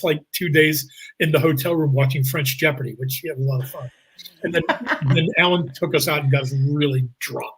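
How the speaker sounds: normal talking voice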